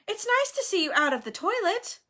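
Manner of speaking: high, forced cheery small talk; falsely bright. A narrator's reading. Hermione making nice to Myrtle, falsely bright. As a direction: chirp it with fake pleasure. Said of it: "in a falsely bright voice".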